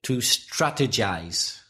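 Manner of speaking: To strategize said slowly, stress on STRA; teacher adding a verb, slowly; slow model word. Shown as a demonstration. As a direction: spell out strategize, STRA stressed and the last part rhyming with size. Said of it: "Can I add one more?".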